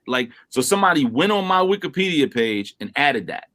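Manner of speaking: usual speaking voice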